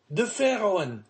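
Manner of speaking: high, animated exclamation; joyful cry. A narrator's reading. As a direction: cry out the great news. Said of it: "he cried".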